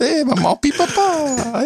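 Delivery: very high, drawn-out mock French; French accent. Host topping his own French joke, exaggerated French accent. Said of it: "A little bit of a French accent".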